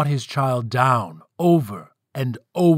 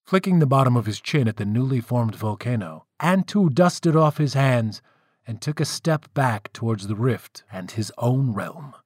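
ordinary delivery, nothing marked

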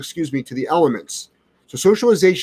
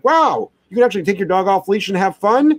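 mock amazement, higher voice; sarcastic; 6 semitones higher than usual. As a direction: fake wonder at the obvious. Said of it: "I'm being a little bit sarcastic".

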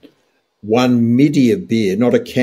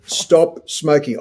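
slow firm command; 6 semitones higher, much slower than usual. Real, not acted. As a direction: stop smoking, slow and higher. Urging listeners to quit.

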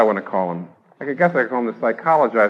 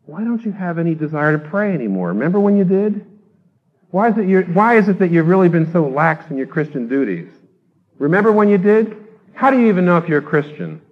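higher, louder nagging accusation; accusing conscience; 6 semitones higher, 5 dB louder than usual. Acted. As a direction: needle yourself like a guilty conscience. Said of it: "your conscience accuses you and comes to you and says".

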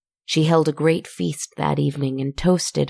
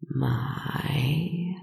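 breathy whispered serpent purr; 3 semitones lower, 4 dB quieter than usual. Acted. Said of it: "the worm purred two words".

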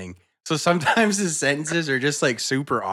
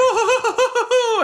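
high sustained "oooh"; whooping in imitation; imitated lawn-mowing whoop; 4 dB louder than usual. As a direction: hold one long, high, hooting note.

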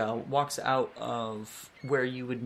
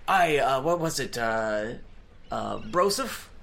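put-on pirate accent; 3 dB louder than usual. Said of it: "making him have a pirate accent".

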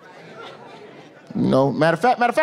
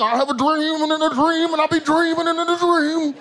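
high, sustained oratorical chant; mock civil-rights orator with held-out notes; Martin Luther King; 8 semitones higher than usual. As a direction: imitate a preacher's ringing speech, pitched up. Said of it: "this motherfucker be Martin Luther King".

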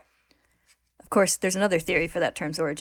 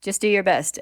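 louder, offhand encouragement; unsympathetic; 3 semitones higher, 6 dB louder than usual. A narrator's reading. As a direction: brush off the complaint. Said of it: "Ed says unsympathetically".

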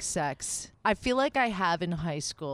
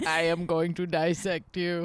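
slow, lightly put-on alien; a hint of an alien. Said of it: "You'd be like".

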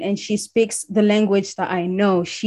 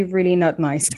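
ordinary talk, nothing marked

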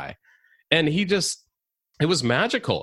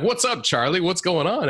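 high-pitched, outgoing sales-guy greeting; high, breezy salesman's hello; Charlie the sales guy; 9 semitones higher than usual. Acted. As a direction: hail someone like a chummy salesman. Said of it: "Charlie, the sales guy, who's".

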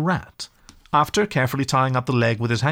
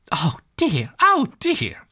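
in despair; 7 semitones higher, much slower than usual. A narrator's reading. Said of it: "cried the rat".

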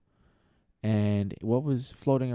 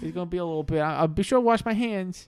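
put-on kid voice; 8 semitones higher than usual. Timmy voice.

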